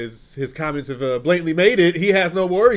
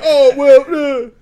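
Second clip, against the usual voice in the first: higher, louder mock whine; mock whining; 6 semitones higher, 6 dB louder, much slower than usual. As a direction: imitate sore losers whining. Quoting whiny reaction to wins.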